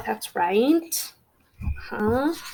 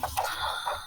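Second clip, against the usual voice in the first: mock gasp; 10 dB quieter than usual. Mock gasp at story problem.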